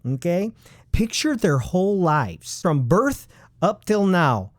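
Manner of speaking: normal talking voice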